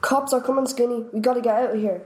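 worried tone. A narrator's reading. Frankie warning Skinny of the police, worried. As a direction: hurry him out. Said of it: "in a worried tone".

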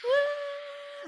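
high whooping cheer; excited cheer. Real, not acted. Cheering friend's new room.

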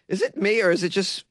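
ordinary delivery

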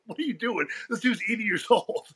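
high, laughing protest; pulling a friend off a fight, laughing; breaking up an old men's fight, laughing; laughing disbelief. Retold or imitated.

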